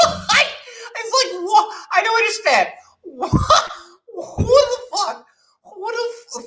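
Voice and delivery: shrieking laughing disbelief; what is this, laughing way up high; real laughing outrage; high incredulous laughter. Real, not acted.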